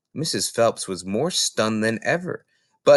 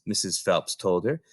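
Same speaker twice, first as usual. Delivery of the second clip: usual speaking voice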